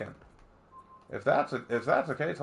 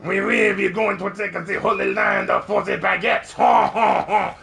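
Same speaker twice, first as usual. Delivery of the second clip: horrible fake French accent; 5 semitones higher than usual. Griffin the French Crusader rallying for the Holy Land, horrible fake French accent. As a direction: boast in a silly French accent and cap it with a big laugh. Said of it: "I have a horrible fake French accent".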